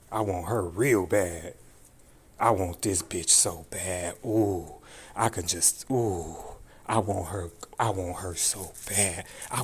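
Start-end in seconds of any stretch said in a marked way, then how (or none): none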